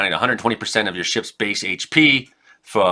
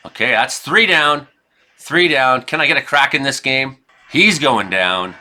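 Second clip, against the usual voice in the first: ordinary talk, nothing marked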